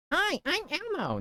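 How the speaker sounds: very high puppet voice; first go at a falsetto children's puppet; Elmo. Shown as a demonstration. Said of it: "You wanna hear my Elmo impression".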